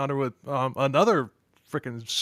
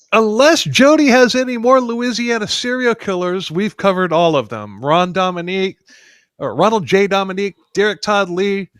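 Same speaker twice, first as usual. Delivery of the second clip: loud; 5 semitones higher, 10 dB louder than usual. Real, not acted. Loud outro to cohost.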